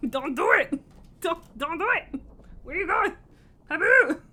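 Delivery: rising shout as the pet runs off; calling out to stop. Real, not acted. Pet heading for trouble.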